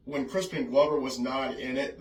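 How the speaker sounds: ordinary talk, nothing marked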